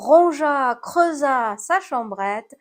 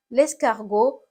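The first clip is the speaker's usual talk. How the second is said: ordinary delivery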